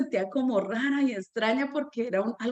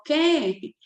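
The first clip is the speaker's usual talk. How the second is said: mild mock yell, pitched up; retold shout; 4 semitones higher, 4 dB louder than usual. Boy answers the teacher while running laps, mock shout. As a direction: imitate a boy yelling back from afar. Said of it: "me gritó".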